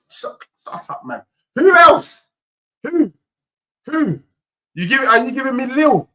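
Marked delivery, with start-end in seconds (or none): 0.1-1.2 unvoiced, much softer shut up; muttered aside. Real, not acted. Streamer cutting himself off mid-name, whispered aside. 1.6-4.2 higher, louder who else; challenging shout. Real, not acted. Streamer daring the group-stage draw, loud challenge.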